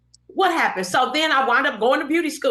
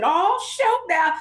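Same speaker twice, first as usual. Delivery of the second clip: surprised; 8 semitones higher, slower than usual. Retold or imitated. Sees friend's fancy gown.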